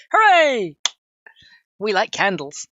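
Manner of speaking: mock cheer. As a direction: cheer it like a party popper. Cheering chat's candle hashtag.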